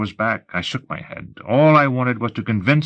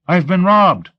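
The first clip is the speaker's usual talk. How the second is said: high, louder despairing cry; 8 semitones higher, 5 dB louder, slower than usual. A narrator's reading. Robbed man pleading with the interrogator, despairing. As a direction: protest desperately with a raised voice. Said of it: "I said despairingly".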